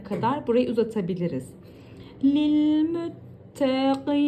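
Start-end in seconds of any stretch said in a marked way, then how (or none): 2.2-4.2 Hüdellil müttegin chanted slowly, double L held; slow recitation. Shown as a demonstration. Teacher reciting the phrase slowly. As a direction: stretch each syllable slowly.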